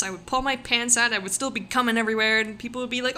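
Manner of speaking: normal talking voice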